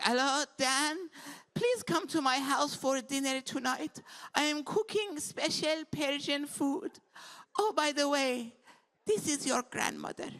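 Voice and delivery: put-on high-pitched Persian grandmother accent; Middle Eastern grandma. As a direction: Middle Eastern grandma carefully leaving a voicemail. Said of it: "old Middle Eastern women calling me".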